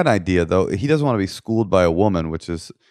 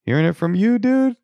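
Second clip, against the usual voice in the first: persuaded husband; 8 semitones higher than usual. Pretend. Husband won over by Vladimir, high mock-impressed voice.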